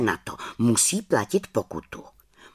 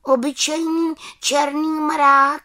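uncertain little witch; 6 dB louder than usual. Acted. Little witch doubtfully answers the raven, high voice. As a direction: ponder it unsure in a high voice.

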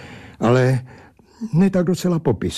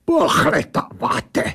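put-on high, drawn-out indignant echo with accent; blurting creature; 4 semitones higher, slower than usual. A narrator's reading. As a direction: fling the word back as an affronted creature. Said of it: "vybafla ta věc". (kreatura vybafne, vysoko a s přízvukem)

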